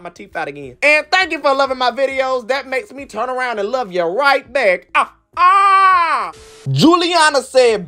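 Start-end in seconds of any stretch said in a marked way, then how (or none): 2.5-6.3 mock crying. Fake crying from gratitude.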